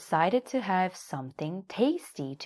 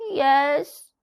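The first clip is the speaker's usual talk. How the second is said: wailing; 10 semitones higher, 8 dB louder than usual. A narrator's reading. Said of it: "wailed Little Donkey".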